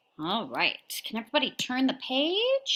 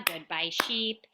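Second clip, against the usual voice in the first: ordinary talk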